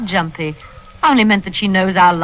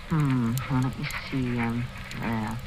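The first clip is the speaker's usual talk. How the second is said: low, much softer murmur while writing; murmured to herself; 8 semitones lower, 12 dB quieter than usual. Acted. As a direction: mumble it as you sign the check.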